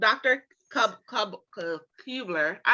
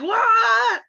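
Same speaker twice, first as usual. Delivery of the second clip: much higher and louder, stunned; shocked surprise; 8 dB louder, slower than usual. Pretend. Fans imagining Ripper's surprise return, shocked shout. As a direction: gasp at a twist you called.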